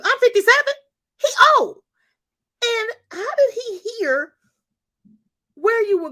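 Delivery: high, loud voice cracking into tears; fighting back tears as it breaks; near tears. Real, not acted. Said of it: "the tears are welling up".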